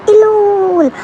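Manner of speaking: loud, high call of her name; desperate loud shout. Retold or imitated. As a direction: yell her name in panic. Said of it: "ज़ोर से चिल्लाता है".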